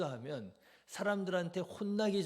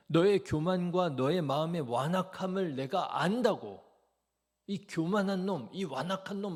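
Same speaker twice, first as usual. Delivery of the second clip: louder, higher insulting rebuke; personal attack; 3 semitones higher, 7 dB louder than usual. Retold or imitated. Eliab insulting young David in front of soldiers, angry. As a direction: lash out at him with insults. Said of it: "인신공격적인 말을 하면서요".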